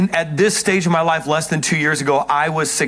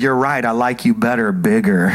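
wife's teasing reply, dropped lower and lower; low imitation of wife; 8 semitones lower than usual. Imitating his wife's teasing admission, dropping low. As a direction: sink the voice down as she teases. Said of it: "And she said".

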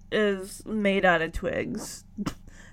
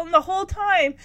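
ordinary talk, nothing marked

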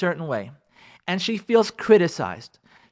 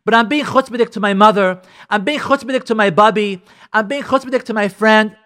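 higher, louder indignant objection; mock protest; 5 semitones higher, 7 dB louder than usual. Wife protesting to the rabbi, indignantly louder. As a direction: push back like an offended wife.

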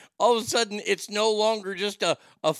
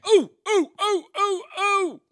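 squealed attention-begging hoots; high, loud pick-me oohs; eager pupil's "ooh, ooh, ooh"; eager high-pitched "ooh ooh"; 5 dB louder than usual. Acted. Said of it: "doing the, the horse check".

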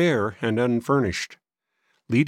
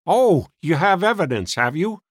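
higher, louder sceptical retort; testy old man; 7 semitones higher, 4 dB louder than usual. A narrator's reading. Old man doubting the boys' evidence, scornful. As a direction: scoff like a crotchety old man.

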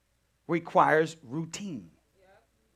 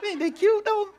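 cooing; 4 dB louder than usual. Real, not acted. Cooing over babies.

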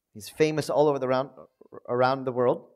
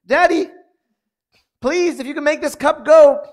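high, loud pleading cry of daddy, please; high desperate plea to a father; begging child to daddy; 10 semitones higher, 7 dB louder than usual. Retold or imitated. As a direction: beg like a child tugging at a father. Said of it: "He's like".

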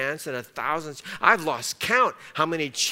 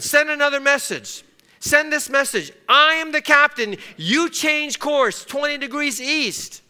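higher, louder commanding orders, ending slow; agitated captain; 8 semitones higher, 6 dB louder than usual. Retold or imitated. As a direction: bark orders at the signalman. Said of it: "He was agitated by the response".

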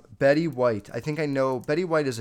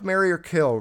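ordinary talk

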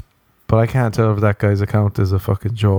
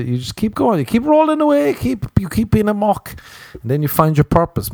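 much higher, sing-song life advice; high mock-motivational rant; mock inspirational; 11 semitones higher than usual. Real, not acted. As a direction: preach it like a squeaky guru.

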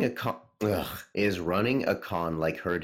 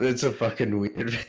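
laughing; 5 semitones higher, 3 dB louder than usual. Real, not acted. Laughing at quote.

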